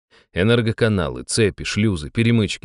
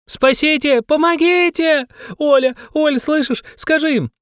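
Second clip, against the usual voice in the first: much higher, shrieking cries for help; screaming in fear; 4 dB louder than usual. Acted. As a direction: shriek for rescue in panic.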